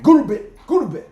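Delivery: high, loud put-on African accent ordering the dog. To his dog who won't stop bothering him, loud mock African accent. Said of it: "speak to him in an African accent".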